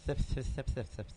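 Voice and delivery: imitating a lisp, "Thip" for "sip"; mock lisping "Thip, thip". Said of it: "you were doing the little lisp thing".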